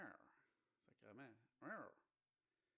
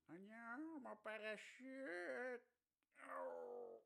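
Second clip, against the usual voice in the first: high drawn-out panic; panicked skydiver voice; 10 semitones higher, much slower than usual. Pretend. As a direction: wail it slowly, high. Voicing skydiver in trouble.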